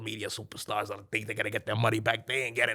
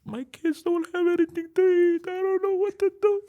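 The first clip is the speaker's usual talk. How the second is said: much higher, louder, frantic woman's voice; distraught mother; 8 dB louder than usual. Retold or imitated. Mother telling Manny her kids have no food, frantic high wail. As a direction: wail it like a panicked mom.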